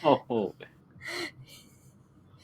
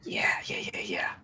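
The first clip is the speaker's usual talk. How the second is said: whisper; 5 semitones lower, 11 dB quieter than usual. Acted. Ghosts sneaking, whispered agreement.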